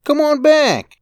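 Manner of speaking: put-on high, loud boy yelling; child's yell. Boy chasing his brother, high loud yell. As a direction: yell after him like a kid.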